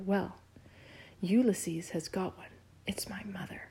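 hushed, fading to a breathy whisper on the last words. A narrator's reading. Flora secretly tells William about the arch nemesis, whispering. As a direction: share the secret under the breath. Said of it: "she whispered".

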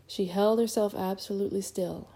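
ordinary delivery

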